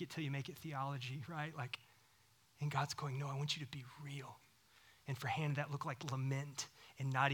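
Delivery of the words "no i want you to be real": soft, tender whispered plea; gentle whisper. Retold or imitated. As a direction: whisper it like God calling softly. God voiced gently.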